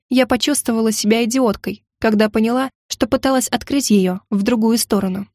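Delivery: ordinary delivery, nothing marked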